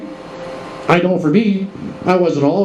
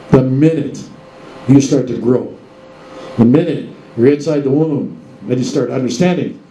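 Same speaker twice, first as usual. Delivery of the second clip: normal talking voice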